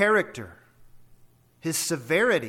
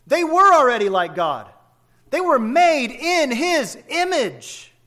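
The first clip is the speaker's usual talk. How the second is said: preaching climax; 8 semitones higher, 8 dB louder than usual. Acted. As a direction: proclaim it with full force.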